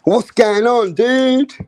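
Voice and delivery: imitating his American cousin; mock American accent. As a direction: do a loud American dude. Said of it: "I used to hear that all the time".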